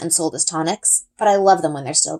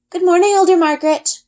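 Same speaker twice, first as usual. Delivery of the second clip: put-on a touch of two girls chorusing, high and bright; lightly voiced polite chorus; chorused greeting; 12 semitones higher, 5 dB louder than usual. A narrator's reading. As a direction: greet the elder in sing-song unison. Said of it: "we chorus".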